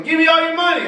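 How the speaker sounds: high, loud mock mugger demand. Mugger demands money outside a Steeler game, hammed-up and loud.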